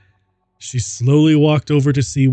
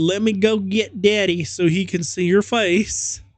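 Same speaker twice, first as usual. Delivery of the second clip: nervous mother; 6 semitones higher than usual. Acted. Mother stalling the killer.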